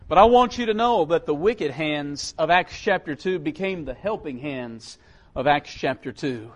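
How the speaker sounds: ordinary talk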